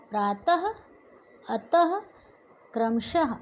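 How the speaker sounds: "प्रातः, अतः, क्रमशः" each ending in a "ha" breath; visarga as half-h. Shown as a demonstration. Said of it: "उच्चारण कैसे? आधे ह के समान".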